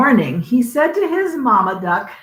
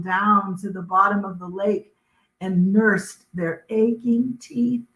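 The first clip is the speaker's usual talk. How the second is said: ordinary delivery, nothing marked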